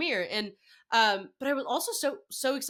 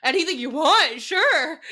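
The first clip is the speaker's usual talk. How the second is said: louder, higher, flustered eager yes; retold freak-out; 4 semitones higher, 8 dB louder, slower than usual. Retelling replying to the admins' message, flustered and overexcited. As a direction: stammer into an over-eager, mounting agreement. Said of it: "I started freaking out".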